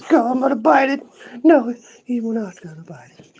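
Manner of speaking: high, loud mock-protesting pet; playful pet voice. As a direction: squeal back as a teased pet. Pet answers the biting threat.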